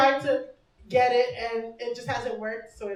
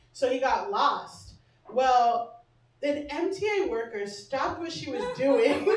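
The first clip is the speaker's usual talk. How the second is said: normal talking voice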